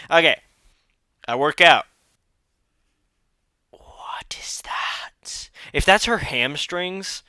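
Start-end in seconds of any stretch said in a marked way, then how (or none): 3.7-5.5 slow, drawn-out whisper; hushed, stretched-out bafflement; appalled whisper. Real, not acted. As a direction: whisper it in disbelief. Baffled by hamstrings on screen.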